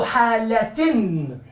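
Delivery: "halatin" stretched slowly, clear t in -tin; slow demonstration of "halatin" with a held final n; pronounced t ending. Shown as a demonstration. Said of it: "hay que pronunciarlo como ta".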